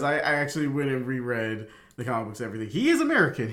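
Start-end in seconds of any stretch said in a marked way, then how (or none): 2.7-3.5 emphatic insistence. Real, not acted. Insisting after rereading the comics.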